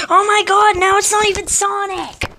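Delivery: high, loud disbelieving complaint; exasperated outburst. Real, not acted. Player finding another attacker on him, exasperated yell. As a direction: cry out in exasperation.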